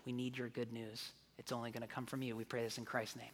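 soft, hushed prayer voice. Real, not acted. Pastor praying softly over the congregation, hushed. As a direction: pray gently over the people.

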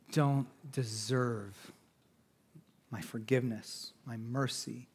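low, very soft, halting refusal; unforgiving grudge-holder. Acted. Someone refusing forgiveness to those who hurt him, quietly unforgiving. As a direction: hold the grudge quietly, word by word.

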